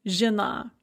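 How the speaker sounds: "zhena" with a hard zh and short i-like vowel; Russian "zhena", reduced first vowel. Shown as a demonstration. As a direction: say "zhena" like zhi-NA, stress last. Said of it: "pronounced kind of like an e".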